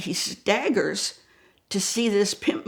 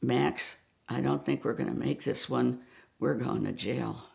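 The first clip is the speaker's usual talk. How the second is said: low, soft muttered dread; hushed worried mutter; under his breath; 7 semitones lower, 6 dB quieter than usual. Retold or imitated. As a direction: mutter it low so no one hears. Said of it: "says under his breath".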